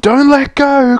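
high, loud storybook cry; acted high-pitched plea to hold on. A narrator's reading. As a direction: call out urgently as the frightened child. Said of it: "cries the child".

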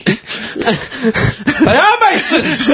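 high, drawn-out conspiracy cry; hammed-up dramatic exposé, laughing; mock revelation.

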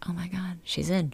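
breathless hushed whisper, building to a soft gasp of a question; tense whisper. Retold or imitated. The NBC crew watching her slip into the house, whispering. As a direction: hold your breath and whisper it.

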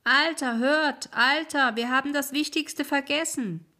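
high, loud call across the water; loud high calling wisps; wisps calling after him. Acted. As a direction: shout after the departing ferryman. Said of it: "als sie ihm nachriefen".

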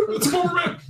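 very high, put-on mocking voice; exaggerated high-pitched mock pity; mock sympathy. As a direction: squeak out fake commiseration. Mockingly echoes listener's sympathy.

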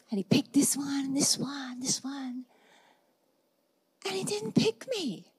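dropping to a whisper of disappointment; hushed whisper. Real, not acted. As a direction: let it fade to a hush. Hushed retelling of not chosen.

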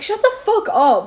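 retorting to a nosy classmate with a loud mock yell; retold outburst. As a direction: snap it at the girl like you did then. Retelling her own outburst.

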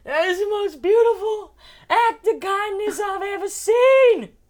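very high, loud, slow mock wail; hammed-up sobbing imitation; hysterical crying. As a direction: wail it out, stretching the words. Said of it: "she starts hysterically crying".

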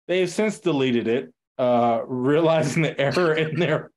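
normal talking voice

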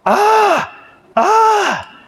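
short high-pitched mock screams; high squealed kid scream; imitated excited scream. Preacher imitating the boy screaming at his present, excited scream. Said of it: "he just starts screaming at it".

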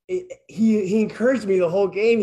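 ordinary delivery

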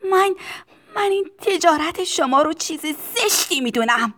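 put-on high child's outburst; loud protest. A narrator's reading. Tistu condemning the owner's trade, high loud protest.

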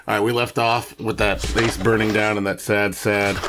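normal talking voice

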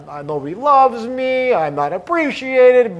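a high, slow, self-pitying whine; whiny inner voice. Retold or imitated. Speaker mocks the little voice in the head, high and slow whining. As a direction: mimic the childhood voice that says you're not enough. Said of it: "You hear all those issues from childhood".

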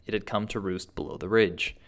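usual speaking voice

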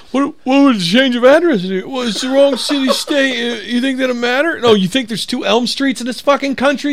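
mock exasperated argument. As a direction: play both sides of the spat, incredulous. Acting out the argument with the buyer.

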